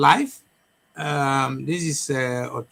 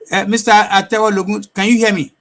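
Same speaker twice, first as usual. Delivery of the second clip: to someone hard of hearing; 4 semitones higher, 6 dB louder than usual. Real, not acted. Calling to guest on bad line.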